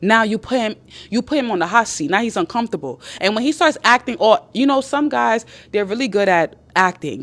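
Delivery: ordinary delivery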